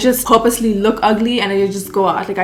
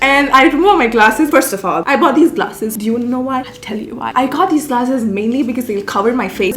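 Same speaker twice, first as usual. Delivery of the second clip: normal talking voice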